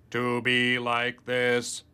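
slow, loud robotic butler voice. Shown as a demonstration. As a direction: speak like a stiff mechanical servant. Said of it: "I wanted Samuel's voice".

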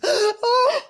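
high gasping oh of a breaking sob; mock sob cry. Tony breaking down in front of the group, sobbing oh. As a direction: let a sob burst out on oh. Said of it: "this huge guttural sob wrenches".

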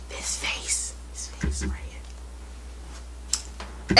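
breathy whisper, slowed right down. Real, not acted. Vlogger teasing viewers to spot him, whispered. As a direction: hush it like a secret about this face. Vlogger points to own face.

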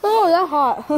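loud burned yelp; loud hot reaction. Real, not acted. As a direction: blurt it out, mouth burning. Reacting to spicy candy.

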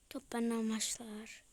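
soft unvoiced whisper. Real, not acted. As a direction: hush the line. Fısıltı. (fısıltıyla)

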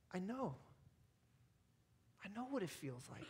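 soft, understanding voice of God; hushed, with pauses, as if consoling; tender reassurance. Acted. Preacher voicing God to the hurting believer, softly reassuring.

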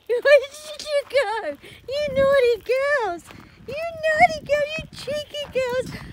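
playful pet-voice telling off; fond mock scolding. Real, not acted. Scolding returned horses fondly.

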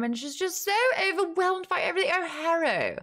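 high, overwhelmed, near tears, slowing down; imitating the narrator's overwhelmed Ianthe voice. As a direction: gush at Harrow as if about to cry. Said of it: "that's how Ianthe talks".

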